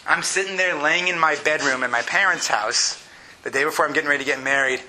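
ordinary talk

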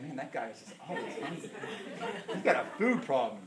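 chuckling through imagined listeners' teasing; laughing mock gossip. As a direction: laugh as you voice what they'll think of you. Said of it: "You're gonna walk out here saying".

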